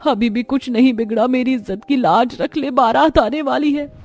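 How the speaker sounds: high, wailing lament at her daughter; anguished mother. Acted. Mother wailing at her eloping daughter, high and anguished. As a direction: cry out in despair and begging. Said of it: "फूट फूट कर रोती रह जाती है".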